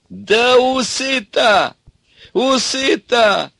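very high, stretched-out wailing; hysterical mirth. Acted. Ivan mocks the daughter's moustache, howling with mirth. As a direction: howl helplessly at the ridiculous sight.

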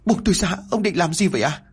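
frantic scream; shouting. Acted. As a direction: scream it out in panic as she fights him off. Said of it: "cô liền gào lớn".

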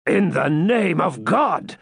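drawn-out, alarmed plea; awestruck appeal. Acted. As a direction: invoke God before an apparition. Cedric confronts supposed ghost.

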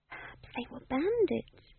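high, soft and slow, nervous; tremulous. Acted. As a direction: offer the guess timidly. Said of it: "said Magrat tremulously".

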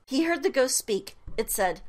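ordinary delivery

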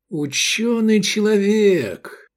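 high, drawn-out humble address. A narrator's reading. Эдик addresses his learned friend, humbly. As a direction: stretch it out high, playing the humble pupil. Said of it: "смиренно сказал Эдик".

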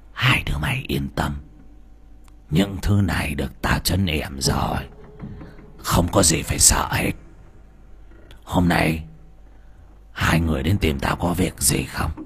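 put-on low whispery sinister old man; creepy old sorcerer. A narrator's reading. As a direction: breathe it like a creepy witch doctor. Voices old sorcerer Tapon.

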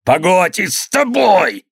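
high, drawn-out threat; threatening shout. A narrator's reading. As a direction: yell a furious threat. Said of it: "крикнул он". (угрожающий крик)

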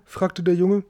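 ordinary delivery, nothing marked

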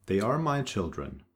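ordinary delivery

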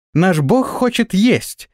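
high, drawn-out proclamation; excited announcement. A narrator's reading. Flyers spread word the god is hungry, excited.

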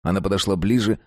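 plain reading voice

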